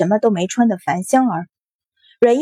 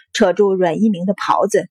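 ordinary delivery

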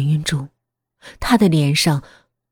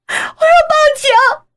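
very high, loud, drawn-out shriek; hysterical scream; 7 dB louder than usual. Acted. Daughter threatens to call the police, in a hysterical scream. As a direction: scream the threat slowly at full pitch. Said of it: "我歇斯底里地叫着".

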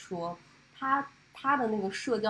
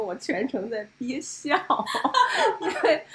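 trying not to laugh; holding back laughter; 5 semitones higher, 9 dB louder than usual. Real, not acted.

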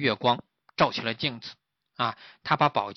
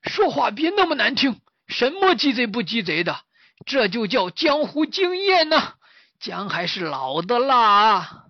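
high, loud, slow boasting; smug old master; 10 semitones higher, 6 dB louder than usual. Acted. Master lecturing the pig about experience, smug and slow. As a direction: lord it over the pig.

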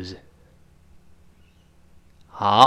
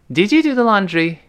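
higher-pitched questioner's voice; first dialogue speaker; 7 semitones higher than usual. Acted. First person asks the second about laundry, higher questioning voice. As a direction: ask brightly in a raised pitch. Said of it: "两个人对话开始".

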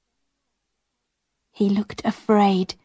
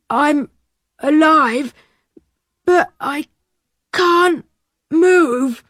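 slow, weak; 5 semitones higher, 3 dB louder, slower than usual. A narrator's reading. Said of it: "very slowly".

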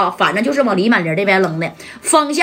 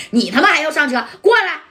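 angry bark; 5 semitones higher than usual. Acted. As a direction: snap him back with a curse. Ma San yanks Shunzi back.

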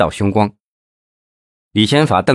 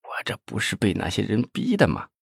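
much softer, barely above a whisper; tiny voice; 10 dB quieter than usual. A narrator's reading. Nephew defends himself to his angry uncle, in a tiny voice. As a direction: shrink your voice to a mosquito's hum. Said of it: "声音像蚊子一样".

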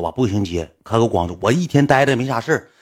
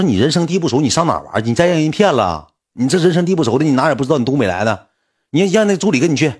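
sister's worried voice; 5 semitones higher than usual. Retold or imitated. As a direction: fuss over him like a bossy older sister. Sister objects.

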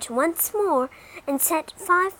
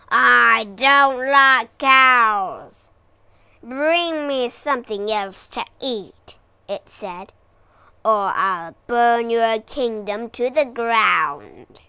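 scowling dragon; 7 dB louder than usual. Acted. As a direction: menace them like a sulky beast.

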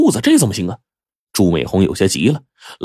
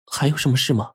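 softer, higher puzzled question; 5 semitones higher, 5 dB quieter than usual. Acted. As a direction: ask without understanding. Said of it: "不解地问说". (不解地轻声发问)

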